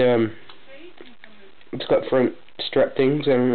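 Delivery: usual speaking voice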